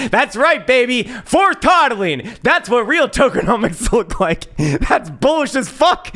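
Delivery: high, loud sarcastic hype; mock hype. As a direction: sell the scam like a pumped-up shill. Mock hyping no-sell tokenomics.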